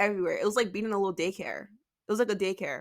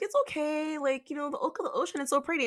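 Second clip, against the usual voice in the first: lightly voiced friend, higher pitch; imitating consoling friend; 7 semitones higher, 4 dB quieter, faster than usual. Friend reassuring her on a disappointing first cruise, lightly imitated. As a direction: pitch it up a little, softly consoling.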